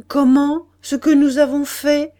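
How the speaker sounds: loud, high, surprised question; astonished. A narrator's reading. Monsieur de Guersin startled by Pierre's reproach, astonished. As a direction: throw the question back in amazement. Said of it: "étonné".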